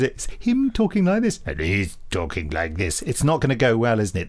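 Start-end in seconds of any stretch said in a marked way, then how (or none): 0.4-1.4 much higher put-on voice; high voice demo. Shown as a demonstration. Host demonstrates one character's voice, high. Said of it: "They're very different voices". 1.5-3.0 much lower put-on voice; low voice demo. Shown as a demonstration. Host demonstrates the other character's voice, low. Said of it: "They're very different voices".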